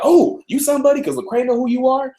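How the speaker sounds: high-pitched mock-amazed onlookers; exaggerated high squeal of "oh, you're somebody"; imitating impressed crowd. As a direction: put on a high voice for the starstruck crowd.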